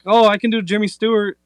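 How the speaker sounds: lightly voiced eager fan. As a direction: brag like an overeager fan. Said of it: "someone's like".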